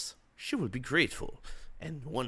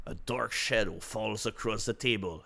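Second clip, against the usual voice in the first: normal talking voice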